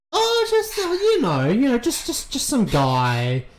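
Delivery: put-on very high, loud, flustered girl voice; bad liar. Lyra fumbling a lie to Coulter, high and flustered.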